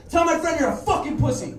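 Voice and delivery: loud, high acted shout; furious order. Dave punches the skinhead and repeats himself, furious shout. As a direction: act out yelling the order in his face.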